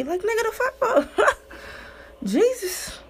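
high, laughing, incredulous; laughing disbelief. Real, not acted. Laughing at her party past, high incredulous.

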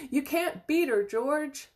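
high, loud cheering shout. A narrator's reading. Onlooker teases George as Grandma out-jigs him, high shout. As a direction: shout it out over a noisy crowd. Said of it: "somebody shouted".

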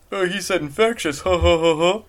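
goofy jeer with a fake laugh; mock it, then force out ha-ha-ha; making fun. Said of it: "I always make fun of that".

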